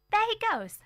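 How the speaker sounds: high, loud pointing out; girl pointing across the street to her mother; excited child. A narrator's reading. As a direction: spot him and call out, thrilled. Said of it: "I said".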